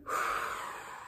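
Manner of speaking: breathy wind blow; soft unvoiced whoosh. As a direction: blow out like a wolf. Wolf blows house.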